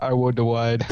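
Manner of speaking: WIDE for "ride", W replacing R; mock R-as-W slip. Co-host joining the tease, mock W in WIDE. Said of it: "You nearly said wide there".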